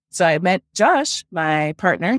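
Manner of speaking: usual speaking voice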